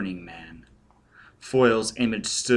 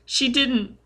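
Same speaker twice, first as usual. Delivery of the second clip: put-on very high frightened child voice; shrieking child; 14 semitones higher than usual. A narrator's reading. Scared child answering Foyle, high and distressed. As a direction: deny it in a small boy's high voice. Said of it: "The child was shrieking and shaking".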